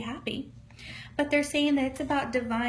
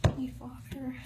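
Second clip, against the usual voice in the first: whispered prayer. Real, not acted. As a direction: breathe the prayer to the Father, no voice. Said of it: "I'm gonna connect with Spirit now".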